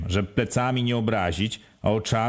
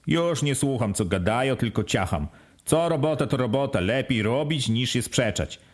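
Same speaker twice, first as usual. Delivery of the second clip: ordinary delivery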